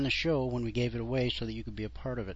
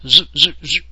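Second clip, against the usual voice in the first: imitated machine noise; 3 semitones higher, 13 dB louder than usual.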